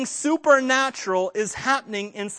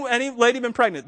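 ordinary delivery

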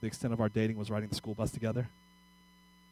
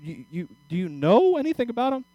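higher and louder, stammering disbelief; incredulous; 9 semitones higher, 7 dB louder than usual. Retold or imitated. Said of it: "My mother goes".

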